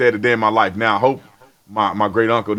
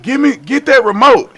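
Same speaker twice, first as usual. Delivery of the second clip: high, demanding order; bossy uncle; 11 semitones higher, 6 dB louder than usual. Retold or imitated. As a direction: boss the kid around. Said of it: "and he'd be like".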